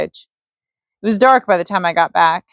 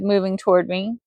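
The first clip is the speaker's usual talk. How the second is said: ordinary delivery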